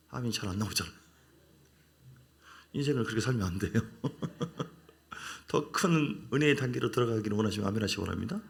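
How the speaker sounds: ordinary talk, nothing marked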